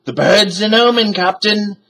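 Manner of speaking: shouted. A narrator's reading. Pirate warns his captain, high fearful shout. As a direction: cry out the omen. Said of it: "shouted Stinky".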